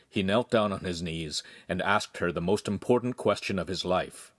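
ordinary delivery

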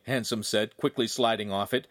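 plain reading voice

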